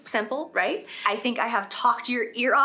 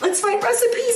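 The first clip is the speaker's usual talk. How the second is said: high, louder exasperated quote of herself; mock pleading; 13 semitones higher, 4 dB louder than usual. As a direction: replay your own ignored plea, pitched up and loud. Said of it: "and I just was like".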